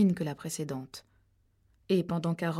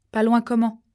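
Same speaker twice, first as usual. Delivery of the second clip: louder, pressing question; sharp question; 4 semitones higher, 9 dB louder than usual. Acted. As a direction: demand it sharply. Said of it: "demanda Rachel". (Rachel presse Harold, question sèche et forte)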